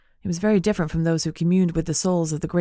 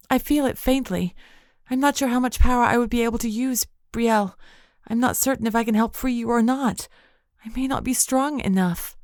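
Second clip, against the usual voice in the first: higher, lighter voice for Nyx, hesitant; a spirit voice, unsure of her strength; Nyx, faint inner voice; 6 semitones higher than usual. A narrator's reading. Nyx answering Brielle, doubtful and faint.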